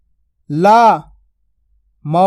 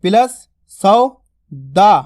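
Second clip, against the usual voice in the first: सौ दा spoken very slowly, louder; slow syllable build; 4 dB louder, much slower than usual. Shown as a demonstration. Teacher building the word syllable by syllable. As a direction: piece सौ and दा together slowly. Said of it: "औ की मात्रा का उच्चारण हो रहा सौ".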